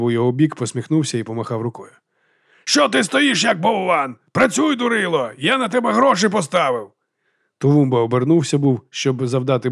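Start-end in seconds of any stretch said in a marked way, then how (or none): 2.6-6.9 high-pitched scolding yell; exasperated, high-pitched heckle; angry heckling. Acted. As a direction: berate the boxer from the crowd. Angry bettor yells at boxer.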